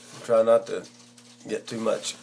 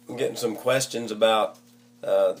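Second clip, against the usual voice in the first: ordinary talk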